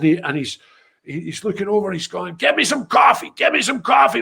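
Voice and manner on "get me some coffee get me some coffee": a retold cop yelling for coffee; mock cop shout. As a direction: bark it like a swaggering cop. Cop barking orders at them.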